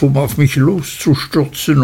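usual speaking voice

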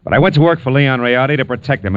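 normal talking voice

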